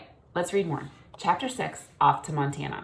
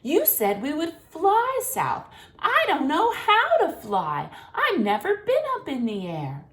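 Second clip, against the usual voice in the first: put-on worried young swan; 10 semitones higher, 5 dB louder than usual. A narrator's reading. Young cygnet telling his father he can't fly, high and worried. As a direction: fret like a small cygnet.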